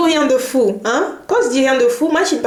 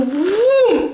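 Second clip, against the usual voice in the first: high, drawn-out "voum"; imitated whoosh of pain; 10 semitones higher than usual. Mother shows how pain seized her back suddenly, high whoosh. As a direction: swoop up high on one sound effect.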